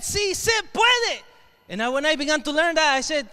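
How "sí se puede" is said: high, drawn-out chant cry; rallying slogan. Retold or imitated. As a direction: call it slowly like a protest chant.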